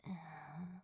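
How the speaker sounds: a quiet, sleepy sigh on waking; soft sigh. Acted. Crow waking slowly from pleasant dreams, soft sigh. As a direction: breathe out softly as you wake. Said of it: "He sighed".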